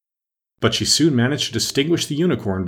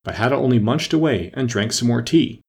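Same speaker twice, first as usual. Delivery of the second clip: usual speaking voice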